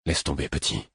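low, soft, quietly firm warning. Acted. Veteran warning a young guard, low and firm. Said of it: "d'un ton d'avertissement".

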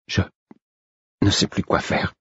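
halting, breathy near-whisper; words breaking apart in despair; broken, whispered confession. Acted. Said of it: "dis-je d'une voix hachée".

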